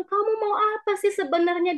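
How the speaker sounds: exasperated cry, acted out at moderate volume; imitated tearful yelling. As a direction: retell her upset outburst, pitched high. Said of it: "Dia sampai teriak-teriak kepada saya".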